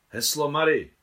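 loud, high, declared password; loudly into microphone. A narrator's reading. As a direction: call it out firmly into the mouthpiece. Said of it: "řekla hlasitě do mikrofonu".

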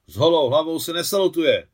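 loud, high-pitched correction; barked officer's rebuke; military reprimand. A narrator's reading. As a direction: tick off a recruit with loud authority. Officer reprimands soldier over salute.

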